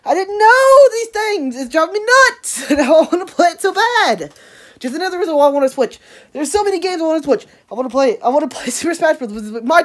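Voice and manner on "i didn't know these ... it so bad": high, slow, emphatic frustration; can't believe you missed it, pitched way up; excited high rant about the game. Real, not acted.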